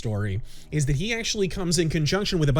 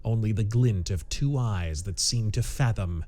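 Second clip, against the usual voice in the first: story narration; 5 semitones lower than usual. A narrator's reading. Narrator unfolding a tense pulp mystery, measured story narration. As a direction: read it deliberately, with suspense between phrases.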